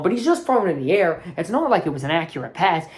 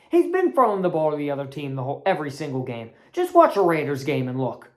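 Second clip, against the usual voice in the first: ordinary delivery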